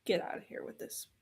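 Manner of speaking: whispered quickly under the breath; hushed, rushed dismissal; fast whisper. Real, not acted. Dismissing the plot device.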